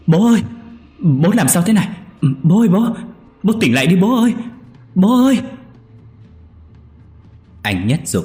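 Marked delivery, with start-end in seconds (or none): 0.1-5.4 very high, loud desperate screams; anguished screaming. Acted. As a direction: scream at your father's body in horror. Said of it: "Anh Nhất hét lên".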